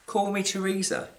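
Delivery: ordinary delivery, nothing marked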